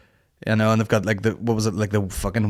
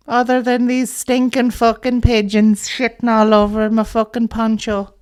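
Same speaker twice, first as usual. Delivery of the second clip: high, slow, dramatic pigeon lady; Kane Toomey's character; 13 semitones higher, slower than usual. Acted. Comic telling the audience, as a high tragic pigeon lady. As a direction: wail it up high and slow like a tragic bird woman. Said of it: "I've turned into Kane Toomey, his wee character".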